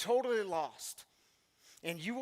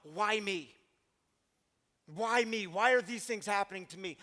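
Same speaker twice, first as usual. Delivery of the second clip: crying out to God; 2 semitones higher, 5 dB louder than usual. Pretend. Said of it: "Cry out to God".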